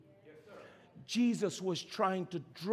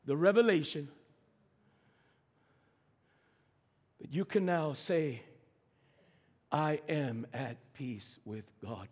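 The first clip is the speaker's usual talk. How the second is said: low, soft, weighty delivery with long pauses; hushed, solemn preaching; 6 semitones lower, 5 dB quieter than usual. Real, not acted. Preacher telling the church they are at peace with God, hushed. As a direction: bring the sermon down to a quiet reflection.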